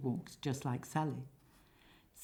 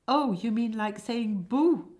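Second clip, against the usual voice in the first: shouted boo; 6 semitones higher, 7 dB louder than usual. Acted. Scare demonstration repeated.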